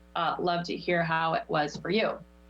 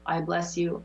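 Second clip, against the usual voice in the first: guided meditation. Acted. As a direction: lead the chant calmly, letting it fade. Leads affirmations and breathing.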